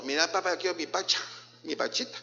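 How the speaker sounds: soft mock toddler talk; child's voice. Preacher imitates a toddler asking for a bottle, softly. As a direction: lisp it out like a small child. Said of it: "hablan así como".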